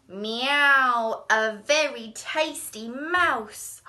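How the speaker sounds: loud, slow, relishing cat; hungry cat. Acted. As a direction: savour it like a greedy cat. Cat savours the mouse.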